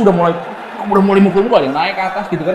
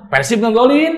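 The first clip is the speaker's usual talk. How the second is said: ordinary talk, nothing marked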